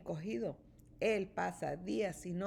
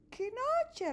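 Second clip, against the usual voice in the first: high little grumble of a mole; grumbling mole; 14 semitones higher than usual. A narrator's reading. Mole grumbles about the stormy night, high voice. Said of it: "rezonga Topo".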